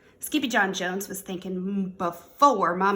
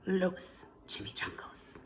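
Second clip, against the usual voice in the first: ordinary delivery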